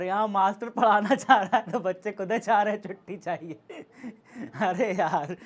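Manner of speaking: laughing while complaining; amused exasperation. Real, not acted. Teacher to pupils who want a holiday, laughing in exasperation. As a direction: break into a laugh of disbelief mid-complaint.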